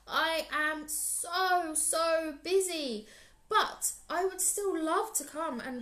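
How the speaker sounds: high, slow, wheedling spider voice; sly pleading spider. Acted. Anansi wheedles Lion to escape the chores, high and slow. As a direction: fake busyness to trick the lion. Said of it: "he had a clever idea".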